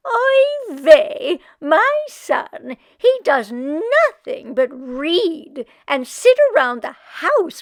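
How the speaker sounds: put-on loud kvetching old woman wailing about her aches. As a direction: moan it out like a put-upon Yiddish grandma. Meshka complains to the dressmaker.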